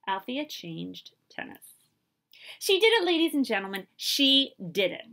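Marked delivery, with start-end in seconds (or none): 2.6-5.1 loud, thrilled sports call; excited announcer. Acted. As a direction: announce the win to the crowd. Announcer calls Wimbledon win.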